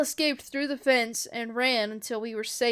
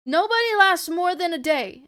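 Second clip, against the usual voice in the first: loud, raised-pitch protest; insistent arguing; 7 semitones higher, 7 dB louder than usual. A narrator's reading. Ellie convincing Tess she isn't infected, insistent. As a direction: argue it forcefully.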